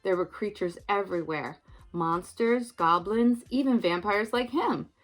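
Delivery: put-on lively read-aloud for children; storytime narration. A narrator's reading. Reading a Halloween story to children, lively storytime voice. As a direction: tell it like a bedtime picture book. Reads a picture book aloud.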